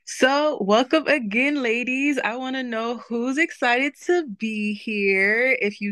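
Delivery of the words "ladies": normal talking voice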